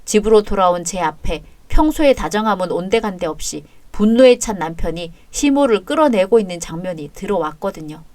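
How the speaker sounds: ordinary delivery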